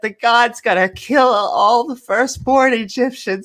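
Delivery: put-on high, anxious child's plea; a scared boy running to his dad; frightened kid voice. Cartman tells the Pharaoh about the plague, scared and high.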